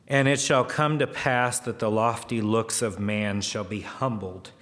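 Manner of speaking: declaimed Bible-style verse, pressing on each "upon"; scripture reading. Acted. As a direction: read the verses out firmly to a congregation. Reads scripture aloud to congregation.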